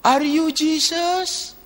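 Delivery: slow, awed asking; wondering question. Retold or imitated.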